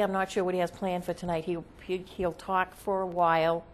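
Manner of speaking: ordinary talk, nothing marked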